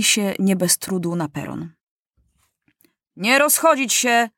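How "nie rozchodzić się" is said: put-on young lieutenant's command, pitch raised; giving an order. A narrator's reading. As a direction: order the troops to stay put. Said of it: "rozkazał młody porucznik".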